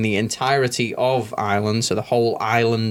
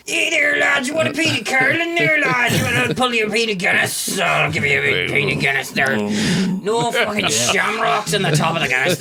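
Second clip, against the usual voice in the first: docker barmaid; 10 semitones higher than usual. Retold or imitated. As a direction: bellow like a docker behind the bar. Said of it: "sounded like a docker".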